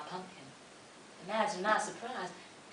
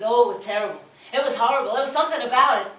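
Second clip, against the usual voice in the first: horrified; 4 semitones higher, 9 dB louder, faster than usual. A narrator's reading. Horror at the chopping.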